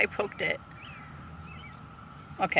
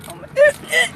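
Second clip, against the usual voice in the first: much higher, louder frightened yelp; startled yelp; 5 dB louder, much faster than usual. Real, not acted. Panicking as the bug moves in her car. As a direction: jolt in sudden panic.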